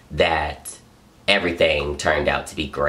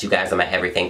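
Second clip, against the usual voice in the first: ordinary talk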